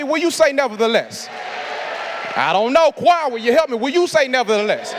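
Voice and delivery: fiery preacher calling the church to respond; preaching at full force. Acted. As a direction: whip the congregation up to answer back. Call and response with congregation.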